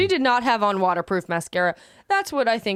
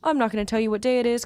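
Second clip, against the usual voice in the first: ordinary talk, nothing marked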